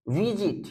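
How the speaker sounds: Visit as VI-zit, weight on the first syllable; unstressed last syllable. Shown as a demonstration. Teacher shows an exception, visit with no stress on the end. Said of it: "इसके last syllable पे जोर नहीं पड़ता है".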